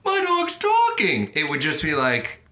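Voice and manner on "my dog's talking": high squeaky mock shock, drawn out slowly; mock astonishment. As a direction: gasp it out as if stunned your dog speaks. Mock reaction to a talking dog.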